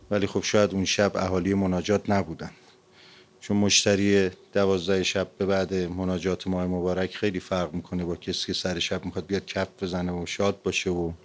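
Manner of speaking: ordinary talk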